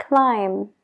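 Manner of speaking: "climb" said as klaim, b dropped; silent b in climb. Shown as a demonstration. Teacher repeats "climb" for the class, b silent. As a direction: stop "climb" on the m. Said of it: "no se pronuncia la B".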